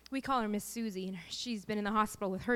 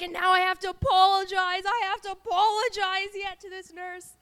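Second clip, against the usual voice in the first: re-enacted loud, high-pitched wail; crying; 9 semitones higher, 8 dB louder than usual. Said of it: "And then I cried to Cody".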